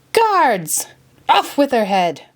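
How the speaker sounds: high, furious command; outraged order. A narrator's reading. The Queen orders Alice beheaded, outraged. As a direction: call the guards in a rage. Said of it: "the Queen cried, outraged".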